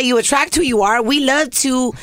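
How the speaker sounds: ordinary talk, nothing marked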